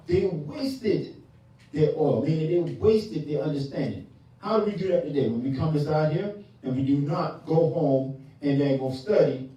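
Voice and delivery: ordinary delivery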